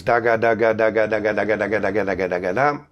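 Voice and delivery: quick "da ga" alternating like double tonguing; rapid double-tonguing syllables; double-tongued da ga. Shown as a demonstration. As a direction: flick "da ga, da ga" fast like a brass player's tongue. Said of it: "Similar to double tonguing".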